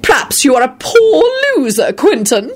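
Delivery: high, snooty needling; irritable sniff. A narrator's reading. Woman needling Quentin after lost chess game, irritably. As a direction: needle him with haughty disdain. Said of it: "Caroline sniffed irritably".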